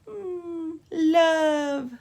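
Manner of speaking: high, loud, stretched "love"; drawn-out sounding out. Shown as a demonstration. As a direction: slide from a high held hum into a long "love". Sounding out an example L word.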